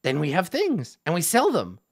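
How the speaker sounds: lightly voiced goblin, simple and eager; goblin Yek.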